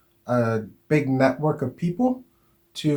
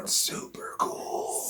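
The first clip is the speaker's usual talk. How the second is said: hushed playful whisper; breathy stage whisper, no voice; 11 dB quieter, slower than usual. Pretend. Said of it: "Why are we whispering?".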